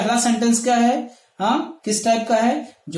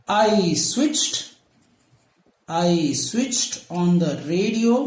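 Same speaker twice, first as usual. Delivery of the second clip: slow dictation; 4 semitones lower, much slower than usual. Shown as a demonstration. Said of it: "teacher dictates sentence".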